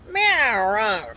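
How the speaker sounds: a grumpy cat telling you off; short falling growly meow; cranky meow. Acted. Cat sound list, bad mood.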